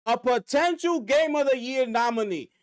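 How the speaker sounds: usual speaking voice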